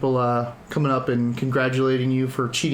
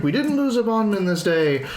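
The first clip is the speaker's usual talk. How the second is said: higher, lightly voiced relieved cheer; happy townsfolk; 6 semitones higher than usual. Game master as townsfolk welcoming the revived adventurer, lightly voiced delight. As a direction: voice a pleased crowd member. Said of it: "people are pretty happy to see you".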